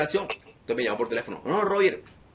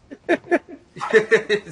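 a loud, high burst of laughter; hearty laugh; 7 semitones higher, 7 dB louder than usual. Real, not acted. Preacher laughing at his own joke about demons, hearty laugh. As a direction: break into a big laugh.

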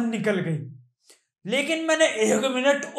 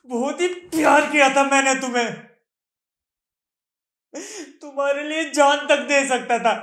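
raised, higher voice full of hurt; anguished outburst; 5 semitones higher, 5 dB louder than usual. Acted. Jilted lover telling Preeti how much he loved her, anguished outburst. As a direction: cry out the love that was wasted. Anguished declaration of past love.

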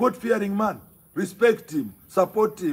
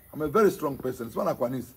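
ordinary talk

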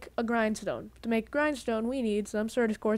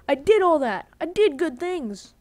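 frustrated outburst; 4 semitones higher, 7 dB louder than usual. Real, not acted.

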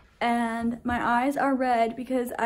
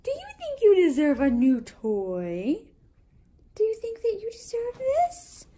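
teasing dog voice; 9 semitones higher than usual. Acted. Teasing dog with new toy.

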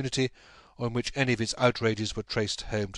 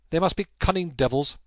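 louder, agitated outburst; exclaiming; 6 semitones higher, 5 dB louder than usual. A narrator's reading. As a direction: spit out the words in agitation. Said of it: "he exclaimed at last".

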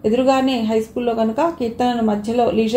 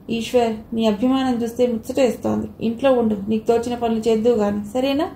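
ordinary delivery

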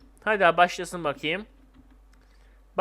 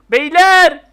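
high, loud hail; big raised-voice call; 7 semitones higher, 11 dB louder than usual. Real, not acted. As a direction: shout to the neighbours. Calls out to neighbours.

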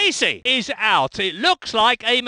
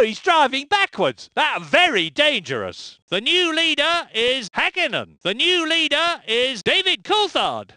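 ordinary delivery

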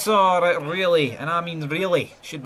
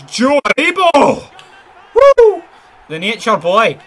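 much higher and louder, shouting the scorer's name; goal celebration roar; 11 semitones higher, 12 dB louder than usual. Real, not acted. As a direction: explode with joy at the goal.

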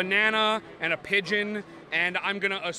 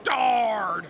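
loud pirate shout of dude; 5 dB louder than usual. Shown as a demonstration. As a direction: bellow it like a pirate. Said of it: "Or a pirate".

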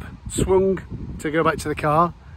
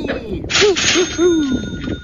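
slow sing-song cheer; 9 semitones higher, much slower than usual. Real, not acted. Cheering over a find.

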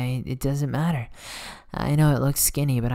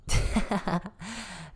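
teasing laugh; 4 semitones higher, 5 dB quieter than usual. Acted. Laughing at flustered listener.

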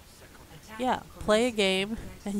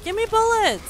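high, loud yell for ammo; shouted demand; 13 semitones higher, 10 dB louder than usual. Real, not acted. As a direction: shout it urgently at your teammates. Demanding ammo during a game.

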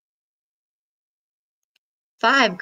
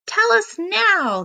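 howling; 11 semitones higher than usual. Acted. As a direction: howl it at her. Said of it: "the crocs all howled".

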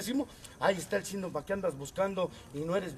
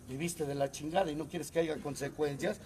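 normal talking voice

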